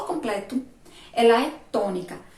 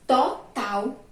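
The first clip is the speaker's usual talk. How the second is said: syllable separation; 2 semitones higher than usual. Shown as a demonstration. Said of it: "porque a sílaba".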